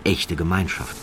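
usual speaking voice

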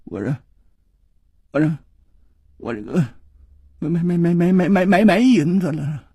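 halting, then rapid-fire stutter; stammering. A narrator's reading. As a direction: trip over the first words, repeating them. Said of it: "口吃着说".

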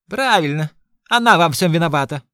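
high, very loud scream; the aunt screams accusingly; shrill screaming. A narrator's reading. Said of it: "Истошно вопит". (истошный вопль)

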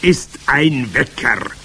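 triumphant. A narrator's reading. Witch leader revealing the alarm clock, triumphant. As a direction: land it slowly as a grand announcement.

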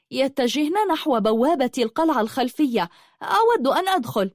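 high-pitched, eager exclamation; excited calling out. A narrator's reading. Habiba points out women heading to the castle gate, exclaiming. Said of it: "صاحت حبيبة".

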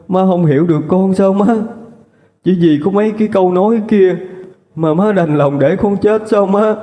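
high, tearful, slowing to a broken end; a heartbroken daughter sobbing to her mother; sobbing plea. Acted. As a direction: cry out the injustice. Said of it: "Kim khóc nấc".

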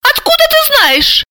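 extremely high-pitched amazed squeal; astonished. A narrator's reading. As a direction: squeak in sheer disbelief. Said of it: "поразилась Алиса".

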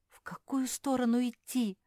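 high, anxious question; nervous. A narrator's reading. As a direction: ask with rising worry. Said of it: "занервничала Жози".

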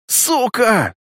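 high, raging yell; furious shout. Acted. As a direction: spit it out in fury. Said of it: "в бешенстве заорал на приближающегося Тон".

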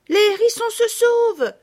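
high, loud alarmed cry; exclaiming. A narrator's reading. Girl alerting the others, high loud cry. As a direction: shout the alarm. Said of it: "s'écria Marguerite".

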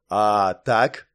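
understated, sly challenge; amused smirk. A narrator's reading. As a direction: pose it low with a smirk. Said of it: "усмехнулся Саш".

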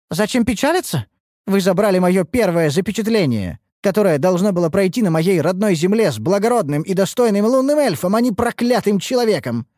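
high, loud reproach; indignant outburst. Acted. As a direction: hurl the grievance at him, outraged. Elf angrily reproaches the master.

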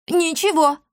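high, frightened blurt; startled old man. A narrator's reading. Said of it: "испуганно промямлил Бергольц". (испуганно, высоким голосом)

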